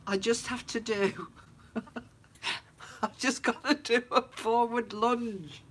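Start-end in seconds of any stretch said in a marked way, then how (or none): 3.0-5.6 slow, apologetic and a touch higher; mock apologetic. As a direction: excuse yourself sweetly, slowly. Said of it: "acts out a lunge to the girls".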